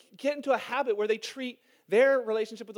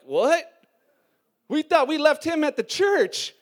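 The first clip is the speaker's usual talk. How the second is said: loud, high-pitched baffled protest; mock shock; 5 semitones higher, 8 dB louder, slower than usual. Preacher plays Corinthians learning Christ went with them, mock shock. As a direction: play the startled congregation protesting.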